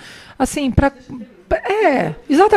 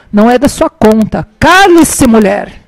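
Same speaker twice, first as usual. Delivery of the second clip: curt rebuke; 7 dB louder, slower than usual. Retold or imitated. Boy answering the nosy shop mother, loud indignant rebuke. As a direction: imitate a boy loudly shutting a woman up. Retold boy's rude retort to woman.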